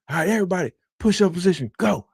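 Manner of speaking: high-pitched mock military orders; drill sergeant's command. Drill sergeant orders punishment push-ups.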